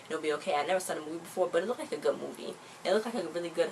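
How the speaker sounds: ordinary talk, nothing marked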